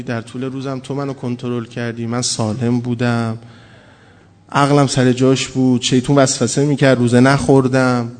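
whispered-soft prayer of gratitude; humble grateful prayer. Retold or imitated. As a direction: confess gently, lowering the voice. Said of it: "ببین جواب قشنگش اینه".

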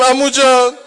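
high, loud mourning wail; uncle's lament to the boy, wailed; wailed cry. Acted. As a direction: call out in grief. Uncle's grief voiced.

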